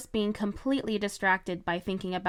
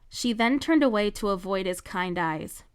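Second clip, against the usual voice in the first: ordinary delivery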